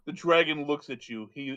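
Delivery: ordinary talk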